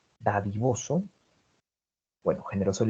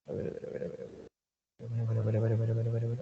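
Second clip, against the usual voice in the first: muttering to self; 8 dB quieter, faster than usual. Real, not acted. Teacher searching, murmuring to herself.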